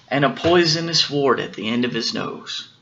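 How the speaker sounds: plain reading voice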